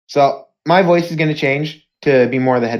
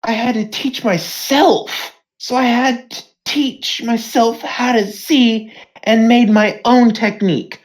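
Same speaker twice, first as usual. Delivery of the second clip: impassioned declaration; 7 semitones higher than usual. Acted. Acted impassioned declaration in fight.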